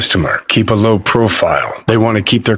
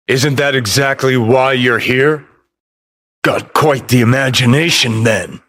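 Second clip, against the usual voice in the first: ordinary talk, nothing marked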